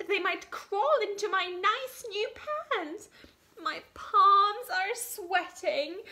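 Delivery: high, trembling frightened cook voice; scared cook. Acted. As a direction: squeak it out like a terrified little cook. Cook lists his fears.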